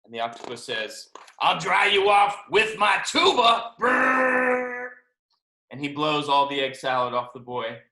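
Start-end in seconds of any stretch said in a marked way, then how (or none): 1.4-3.8 loud, higher, drawn-out reveal; gleeful octopus announcement. Acted. Octopus offers to dry the boy, loud proud announcement. 3.8-4.9 high, loud trilled blare; long buzzing brrr like a horn; imitated tuba blast. Octopus blows egg salad off the boy, loud tuba noise.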